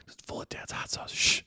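hushed, voiceless imitation of a secret; secretive whisper. Brother letting his twin sister in on the prank, mock whisper. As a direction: whisper it conspiratorially, then shush.

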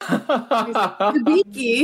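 slow, drawn-out dopey hunk; himbo voice. Retold or imitated. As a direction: stretch it out like a dim macho hunk. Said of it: "hear it said in [name]'s himbo voice".

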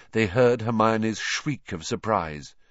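plain reading voice

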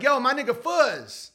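hint of a street greeting; street guy greeting. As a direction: call your buddy by his street name. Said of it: "When it's like".

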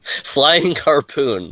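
drawn out, slow; slow savoring repeat. Real, not acted. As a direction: savor each word slowly. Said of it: "That's even better".

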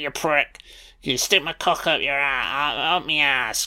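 hammed-up Northern grumble while grappling; lightly voiced priest in a headlock, cursing; comic brawling priest. Priest voiced fighting the demon.

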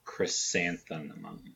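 stretched out, careful; slow careful word. Real, not acted. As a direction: say it slowly, unsure.

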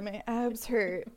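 ordinary delivery

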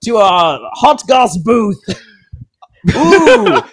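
high, loud carnival barker call; snake oil salesman. Acted. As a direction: hawk it like a sideshow huckster. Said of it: "we're like snake oil salesmen right now".

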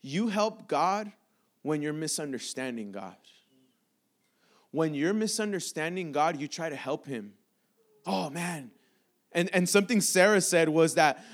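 ordinary delivery

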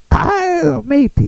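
put-on pirate accent pitched far up, loud; high pirate girl voice. Comic trying the pirate and girl voice at once, high pirate accent. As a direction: squeal it like a girly pirate. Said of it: "do the pirate and the girl voice".

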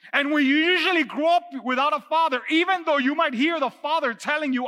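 loud, high-pitched preaching; forceful preaching. Real, not acted. Pastor challenges Moses for still arguing, forceful preaching.

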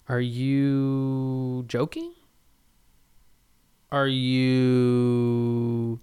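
very slow, stretched-out question; drawn-out disbelief. Retold or imitated. Surprised diner questioning the mad scientist, slow drawn-out disbelief. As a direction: hold the words out as if stalling.

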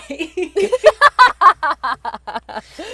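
breathless, staccato fake laugh; nervous fake laugh. Shown as a demonstration. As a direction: laugh like you're about to sprint away. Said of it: "That's what my laugh sounds like".

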